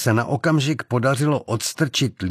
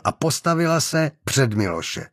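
ordinary delivery, nothing marked

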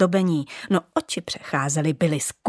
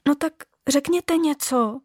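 higher, asking for help; pleading; 7 semitones higher than usual. Acted. Little bauble pleading with the other ornaments, hopeful. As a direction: beg the others to speak up.

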